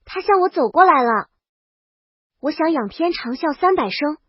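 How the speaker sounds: plain reading voice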